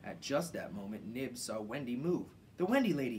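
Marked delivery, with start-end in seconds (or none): 2.6-3.2 loud, high excited call; excited cry. A narrator's reading. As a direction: shout the news to everyone. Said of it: "he cried".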